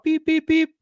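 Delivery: high beeping tones; imitated radio beeps. Officer mimicking the dispatch tone, high beeps. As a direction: beep like a dispatch alert.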